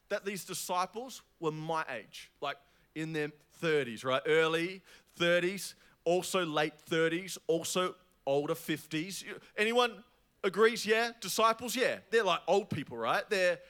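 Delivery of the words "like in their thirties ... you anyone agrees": ordinary talk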